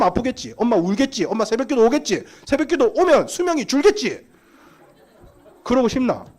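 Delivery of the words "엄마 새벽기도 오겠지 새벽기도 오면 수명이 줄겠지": usual speaking voice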